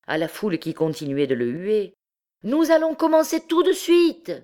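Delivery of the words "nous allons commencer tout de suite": high, loud public announcement; full-lunged shout. A narrator's reading. Jupiter promising the crowd a start, shouting. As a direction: project it over the hooting crowd. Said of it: "cria-t-il de toute la force de ses poumons".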